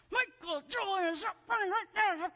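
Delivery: put-on high, loud strained yell at a runaway kid; ironic "nicest mommy voice". Mother yelling at son running through parking lot. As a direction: shout the full name, anything but nice. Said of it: "in my nicest mommy voice".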